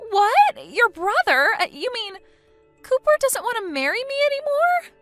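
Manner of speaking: high, loud distraught wail; distraught shock. Acted. Bride hearing her fiancé left her, distraught wailing. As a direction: fall apart at the news.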